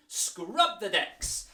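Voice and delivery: loud, slow scrubbing call; called command. Shown as a demonstration. Teacher calling the scrub command, loud and slow. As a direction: stretch it out as you scrub.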